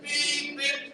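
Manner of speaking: high imitated horn beeps. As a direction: copy the horn sound.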